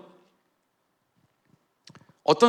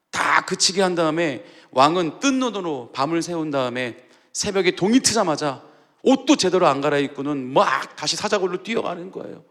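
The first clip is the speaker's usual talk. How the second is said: ordinary talk, nothing marked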